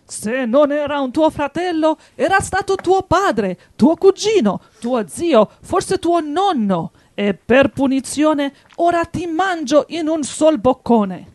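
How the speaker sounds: loud, high raging wolf; angry wolf. A narrator's reading. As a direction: shout the threat before pouncing. Wolf threatens to eat lamb.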